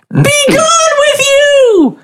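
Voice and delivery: very high, slow, drawn-out scream; wizard's shrieking battle cry; screamed spell cry. Acted. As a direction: banish the monster with all you've got. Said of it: "just scream".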